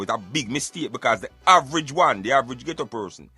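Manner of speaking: ordinary delivery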